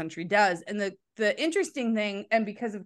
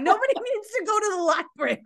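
laughing; 11 semitones higher, 4 dB louder, faster than usual. Real, not acted. Laughing at useless phrase.

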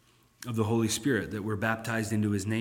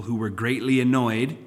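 ordinary talk

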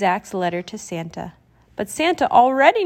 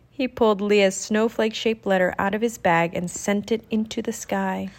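usual speaking voice

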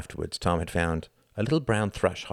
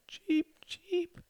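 very high, soft falsetto cheeping; cried bird cheep; 4 dB quieter than usual. A narrator's reading. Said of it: "she cried".

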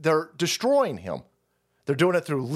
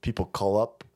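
mock smug lawyer; 8 semitones lower, 3 dB quieter than usual. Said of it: "the fucking shyster lawyer goes".

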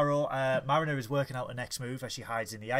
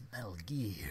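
whisper; 7 semitones lower, 10 dB quieter than usual. Pretend. Game-voice whisper.

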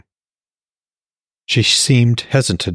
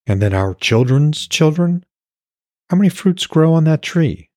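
a touch higher, gently questioning woman; lightly voiced woman; 10 semitones higher than usual. A narrator's reading. Woman pressing her lover about their children, lightly voiced. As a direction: lift the voice a little for her.

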